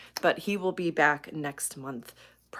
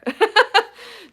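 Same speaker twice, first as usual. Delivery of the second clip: high, loud burst of laughter; 13 dB louder than usual. Real, not acted. Host relieved someone has joined the stream, laughing loudly.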